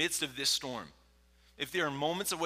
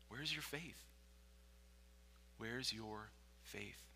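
soft, searching question, slowing on the repeat; hushed rebuke; 13 dB quieter, slower than usual. Acted. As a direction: ask it quietly, letting it sink in. Jesus' rebuke turns hushed.

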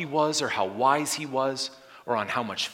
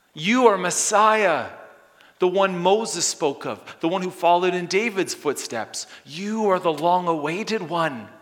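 fervent proclamation; 7 semitones higher, 6 dB louder than usual. Acted. Preacher proclaiming Jesus as Messiah, loud and fervent. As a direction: proclaim it with fire.

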